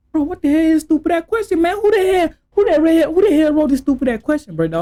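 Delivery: high, fast, incredulous rant; exasperated outburst. Pretend. Standing in the middle objecting to the question, exasperated outburst. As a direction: act out a fast, high-pitched protest.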